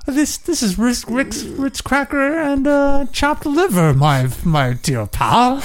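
high and slow snooty butler; posh voice. Acted. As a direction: announce the snack like a prissy butler.

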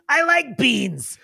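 high, slow goblin voice; a simple goblin, drawn out and high. Acted. Spag contradicting his brother about beans, high slow goblin. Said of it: "Spag goes".

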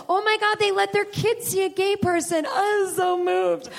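high, gushing mock-proud voice; gushing straight viewer. Comic mocks a straight viewer, gushing and high. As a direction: overdo the proud, moved ally.

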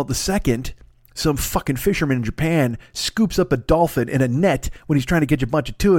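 ordinary talk, nothing marked

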